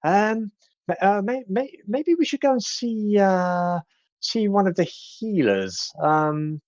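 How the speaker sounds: high fast fussing granny voice; sweet old lady. Acted. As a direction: fuss over the old fellow in a high quick voice. Kettlestein voiced, flustered.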